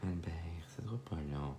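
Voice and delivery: whispered aside to the dog, very soft; soft whisper to Albert, her dog. Real, not acted. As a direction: hush it so the stream barely hears.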